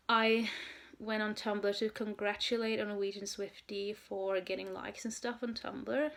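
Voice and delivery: ordinary delivery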